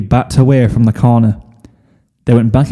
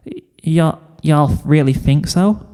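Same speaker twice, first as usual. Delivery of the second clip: shy southern stammer; 4 semitones higher, 3 dB quieter than usual. Acted. Applejack flustered, drawled.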